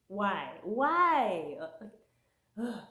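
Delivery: exasperated why. Retold or imitated. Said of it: "I was like".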